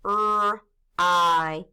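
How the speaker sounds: R and I held out as separate sounds; sounding out. Shown as a demonstration. Teacher tapping out fry for pupils, sounded out. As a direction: stretch R, then I, one sound at a time. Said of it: "Let's tap it".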